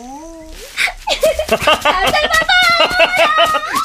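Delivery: high giggling laugh while running; playful laugh. Acted. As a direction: laugh as you chase her. Flirty chase laughter.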